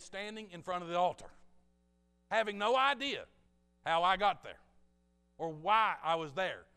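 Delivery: very loud, high retold testimony; bewildered testimony. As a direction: belt out each phrase with preacher's force.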